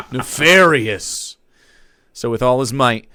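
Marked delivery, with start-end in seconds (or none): 0.1-1.4 slow, drawn-out sinister relish; nefarious. Acted. Narrator relishing the villainy, slow and sinister. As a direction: savour the word like a villain. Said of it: "spooky aside".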